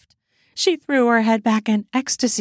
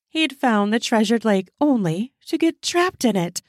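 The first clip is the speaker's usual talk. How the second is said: usual speaking voice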